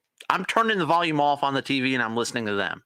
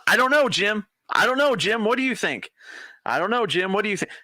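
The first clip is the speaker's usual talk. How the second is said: mocking Romo; 6 semitones higher than usual. Cohost mocks Romo's booth banter.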